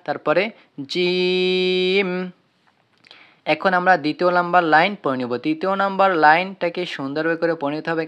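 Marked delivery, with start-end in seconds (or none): 0.9-2.1 জিম drawn out very long, three alif; long drawn jim. Shown as a demonstration. Teacher drawing out জিম for students. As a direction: stretch জিম slowly like a Quran teacher. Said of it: "যেমন".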